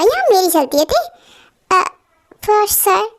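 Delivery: usual speaking voice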